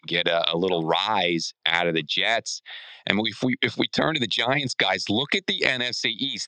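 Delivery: ordinary delivery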